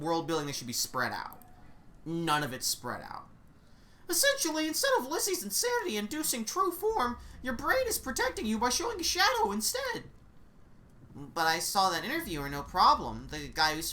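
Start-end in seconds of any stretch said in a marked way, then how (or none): 4.1-10.0 raised, brisk know-it-all guide; tour guide voice. Acted. Guide explaining the strange school to the new witch, brisk and raised. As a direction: rattle off the lore like a cheery guide.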